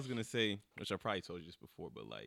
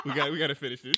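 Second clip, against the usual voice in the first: much louder, laughing through the words; 6 semitones higher, 15 dB louder than usual. Real, not acted. Interviewer urging the teary guest to finish, laughing. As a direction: push on while still laughing.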